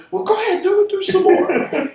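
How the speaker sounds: very high, loud host voice, laughing; host urging. Retold or imitated. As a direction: egg the comic on gleefully. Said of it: "And he goes".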